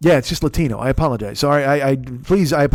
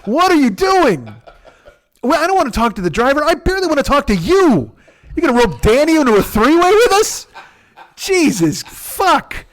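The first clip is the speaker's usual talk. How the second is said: much higher, loud, disbelieving mock outrage; exasperated rant; 9 semitones higher, 3 dB louder than usual. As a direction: blow up at your friend in disbelief, pitched way up. Exasperated inner rant at friend.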